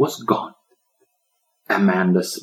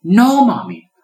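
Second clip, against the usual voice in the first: put-on high, loud defiant child; a little girl defying her mother, high and loud; defiant exclamation; 10 semitones higher, 6 dB louder than usual. A narrator's reading. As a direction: stamp your foot with the words. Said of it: "she exclaimed defiantly".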